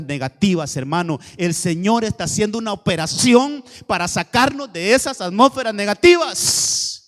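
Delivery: loud proclamation, starting low and climbing; full-force preaching. Real, not acted. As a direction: declare it forcefully as God's promise.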